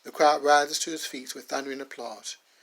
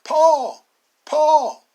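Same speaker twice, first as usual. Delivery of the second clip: very high, loud calling of the name; a little boy calling out; cry out; 12 semitones higher, 12 dB louder than usual. Acted. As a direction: yell for help across the yard. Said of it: "starts to cry out".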